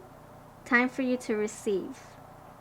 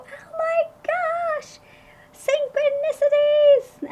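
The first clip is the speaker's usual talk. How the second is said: much higher, louder squeal of delight; high-pitched awe; 9 dB louder, slower than usual. Shown as a demonstration. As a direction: squeal it like a kid in a candy store. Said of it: "My, my voice gets high-pitched".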